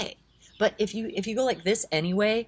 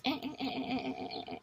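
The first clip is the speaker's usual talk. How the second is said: chattering mind noise; 7 semitones higher, 10 dB quieter, faster than usual. Retold or imitated. Mimics a restless, chattering mind.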